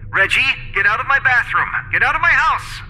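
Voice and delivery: high-pitched, insistent order; indignant female character voice; angry woman. A narrator's reading. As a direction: climb in pitch, demanding he leave.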